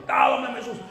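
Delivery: high-pitched re-enacted command; mock yell of a preacher casting out a demon; retold prayer shout. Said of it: "saya terus berteriak".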